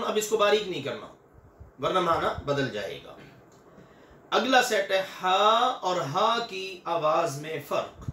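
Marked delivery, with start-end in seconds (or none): none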